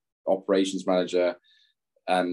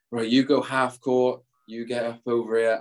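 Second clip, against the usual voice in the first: imitated coach; 4 semitones higher than usual. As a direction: call out the drill like a coach. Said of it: "and he was like".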